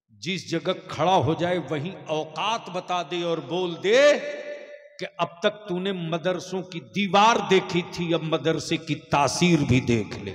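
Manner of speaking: shouted sermon climax; thundering preacher shout. Real, not acted. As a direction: hurl it at the crowd like a challenge. Fiery climax of madrasa appeal.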